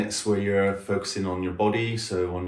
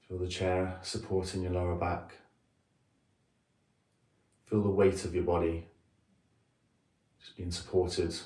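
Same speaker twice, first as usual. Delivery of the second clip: softer meditation voice with long pauses; guided meditation; 4 dB quieter than usual. Acted. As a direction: guide them gently into a body scan, leaving space between phrases. Leads a guided body scan.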